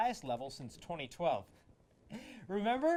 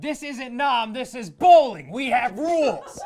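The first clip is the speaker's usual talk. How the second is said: loud, higher, laughing shout; movie rant shout; 7 semitones higher, 13 dB louder than usual. Acted. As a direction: bellow it like an outraged bowler. Quoting Big Lebowski bowling rant.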